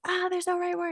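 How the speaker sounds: high-pitched imitation of anxious fretting; mock anxious. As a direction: act out a little word-choice panic. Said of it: "anxiety about".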